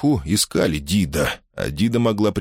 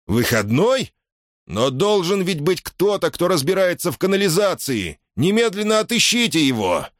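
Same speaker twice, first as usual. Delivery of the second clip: impatient king; 10 semitones higher than usual. A narrator's reading.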